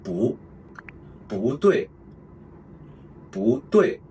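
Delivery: bu rising before a fourth tone, as in 不对; second tone bu. Shown as a demonstration. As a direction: lift bu, then drop 对 sharply. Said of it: "pronounce bu as the second tone".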